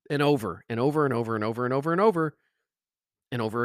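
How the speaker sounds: a fast, breathless list of overs; rapid repetition. Shown as a demonstration. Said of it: "demonstrating endless repetition".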